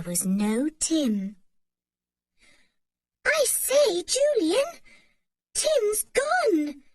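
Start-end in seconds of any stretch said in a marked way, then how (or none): 3.2-6.8 high, anxious voice; alarmed that the dog is gone; scared voice. A narrator's reading. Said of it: "said George in a scared voice".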